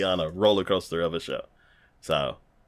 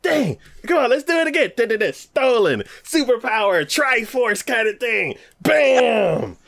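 high, hyped mock anime voice; breathless-kid enthusiasm, pitched way up; anime match play-by-play; 14 semitones higher, 3 dB louder than usual. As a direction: act out the big move, ending on a loud blast.

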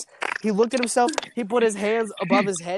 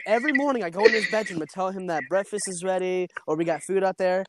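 normal talking voice

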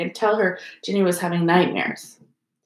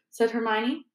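ordinary delivery, nothing marked

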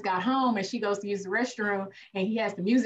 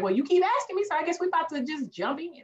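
higher, sassy fed-up warning; mock fed-up woman; 8 semitones higher than usual. Woman warning her pressing partner, sassy and fed up. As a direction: lay it on with attitude. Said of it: "But it's like".

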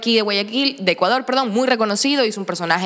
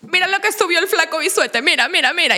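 high-pitched, urgent fan mom; excited mother; 8 semitones higher than usual. Retold or imitated. Mother waking her daughter to show a video, excited. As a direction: pester someone to look, repeating eagerly. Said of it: "mi mamá un día me despertó en pandemia a decirme".

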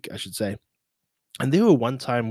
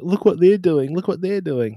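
mocking rivals; 8 semitones higher, 4 dB louder than usual. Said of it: "Fit Aid staff being like".

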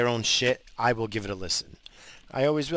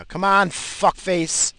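loud, higher, drawn-out yell; real road rage; 8 semitones higher, 6 dB louder, much slower than usual. Real, not acted. Driver scolding someone in traffic, real angry yell. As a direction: holler it slowly at the driver.

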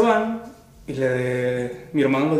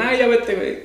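shooing him off; 7 semitones higher than usual. Retold or imitated. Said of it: "le digo".

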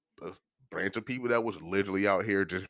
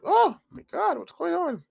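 high, flustered mock woman's voice; a high mock shriek of confusion; Monica Lewinsky; 3 dB louder than usual. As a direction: squeal it out, shocked and flustered. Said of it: "Monica Lewinsky was like".

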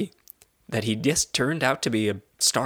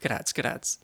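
whispering; 6 dB quieter than usual. Pretend. Bookie aside.

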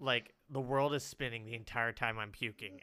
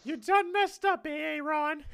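high, put-on taunting voice; mocking stomach voice; 9 dB louder, slower than usual. Stomach taunting the sick friend, high silly mockery. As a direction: tease him in a silly squeaky voice.

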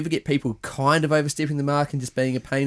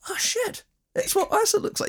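much higher squeal of surprise; mock shock; 4 dB quieter than usual. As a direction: gasp it out, stunned. Imitating a fan's shock.

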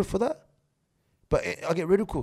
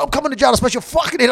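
fast, loud, raised-pitch threat; retold angry threat; 5 semitones higher, 6 dB louder, faster than usual. Retelling the row with the jailed man, angry threat. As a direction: rush the threat out, acting furious.

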